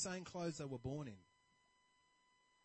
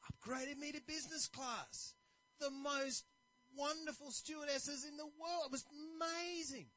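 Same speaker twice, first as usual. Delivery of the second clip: delighted traveler; 8 semitones higher than usual. Retold or imitated. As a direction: swoon over the memories. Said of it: "he's really happy".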